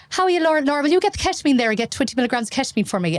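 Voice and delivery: high, quick, gushing chit-chat request; mock chatty. Doctor parodies chatting to Laura during resus, mock chatty. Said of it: "this is not the time for".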